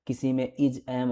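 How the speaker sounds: ordinary talk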